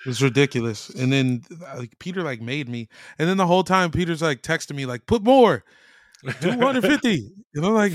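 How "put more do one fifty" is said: pushy friend. Retold or imitated. Peter texting, voiced quote.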